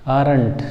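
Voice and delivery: आरंट in two syllables, r sounded; everyday wrong आरंट with a clear r; common misreading. Shown as a demonstration. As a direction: read आरंट as "aa-rant". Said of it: "यही पढ़ते हैं ना".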